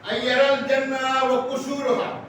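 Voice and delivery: Arabic recitation. Real, not acted. Preacher quoting the Arabic text to the congregation, slow recitation. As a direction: recite loudly and slowly, stretching the long vowels.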